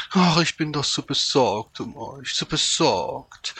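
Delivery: high, loud show of concern; syrupy, exaggerated flatterer; fawning fox. A narrator's reading. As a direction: gush with fake worry, drawing it out. Fox fawns before the dog.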